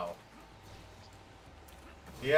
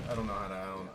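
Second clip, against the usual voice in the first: ordinary talk, nothing marked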